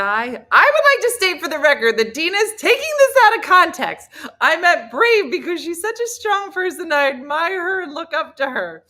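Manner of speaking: high, loud self-defence; loud protest. Real, not acted. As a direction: protest at full volume to clear yourself. Protests being quoted out of context.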